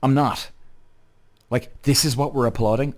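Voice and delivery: ordinary delivery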